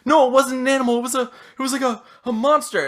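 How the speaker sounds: high, halting frightened voice; acted fright, loud start then faltering; panicked victim. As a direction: stammer it out high and shaken. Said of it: "he's just huffing and out of breath".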